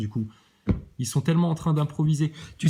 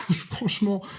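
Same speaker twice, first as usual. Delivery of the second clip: en riant; 6 semitones higher, slower than usual. Real, not acted. Rire moqueur devant le sketch.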